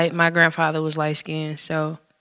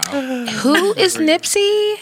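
very slow, high mock cluelessness; Rebecca voice; 12 semitones higher, much slower than usual. Shown as a demonstration. Showing co-hosts her work voice, put-on Rebecca voice. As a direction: drag out the question, pitched up, playing clueless. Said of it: "I throw on my Rebecca voice".

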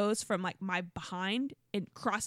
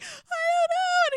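much higher, louder, slow sob; crying helplessly; 8 dB louder, slower than usual. Retold or imitated. Wife to husband on floor, high slow sob. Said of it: "And I was like".

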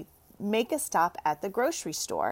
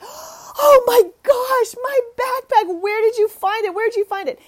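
shrieking, much higher and louder, near tears; erupting with joy; 9 dB louder than usual. Retold or imitated. Mother sees deli worker bring back her backpack, shrieking joy. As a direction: burst out in relieved, crying joy. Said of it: "I erupt".

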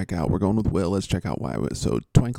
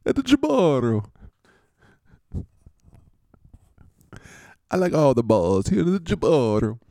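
high, hammed-up Italian pizzeria guy; mock Italian accent; 5 semitones higher than usual. Jaboro guy consoling a frustrated quarterback, mock Italian accent. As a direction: swoop up in a cartoonish Italian accent. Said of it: "here comes the Jaboro guys".

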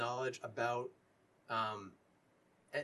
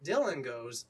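ordinary delivery

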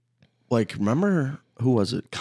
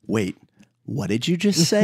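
mock shock; 4 semitones higher than usual. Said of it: "nothing better than going".

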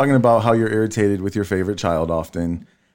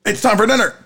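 high mock call to dinner; high-pitched caricature of being summoned; nagging call, imitated; 13 semitones higher than usual. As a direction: exaggerate a partner's demanding dinner call, much higher. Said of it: "That's how I heard it".

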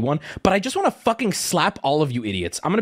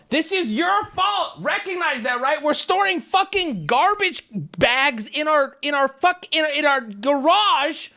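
high, slow, drawn-out outrage; mad rant; 8 semitones higher, slower than usual. Retold or imitated. Customer blaming trash company for garbage in garage, furious. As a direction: stretch each accusation out in worked-up fury. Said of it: "I'm so mad".

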